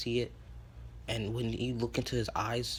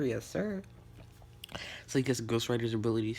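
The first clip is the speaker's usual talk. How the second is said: plain reading voice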